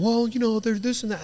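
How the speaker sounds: high-pitched mimicked excuse; mock excuse-making.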